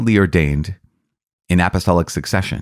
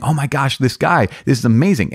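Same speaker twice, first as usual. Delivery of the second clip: higher, gushing delight; excited gushing; 7 semitones higher than usual. Retold or imitated. Catholics thrilled by a convert's discovery, gushing excitedly. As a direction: mimic thrilled fans gushing over the news. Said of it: "a lot of Catholics got super excited going".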